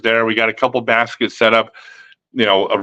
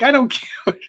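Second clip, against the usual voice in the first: laughing defiance. Retold or imitated. His defiant inner thought.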